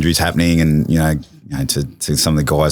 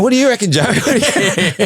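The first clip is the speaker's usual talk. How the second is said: much higher, laughing nervous plea; flustered, laughing; faster than usual. Retold or imitated. A flustered young captain asks teammate Joey for help, laughing nervously. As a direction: imitate your panicked self, laughing through it. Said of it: "I went to water".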